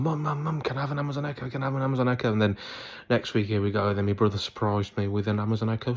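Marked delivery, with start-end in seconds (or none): 0.0-2.3 higher, softer, repetitive begging; imitated pleading in a small high voice; pleading to Mum. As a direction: pester Mum like an eager kid. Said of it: "I said to Mum".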